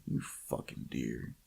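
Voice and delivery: slow, low, hushed curse; muttered annoyance. Retold or imitated. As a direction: curse the animal under your breath. Retells finding deer outside tent.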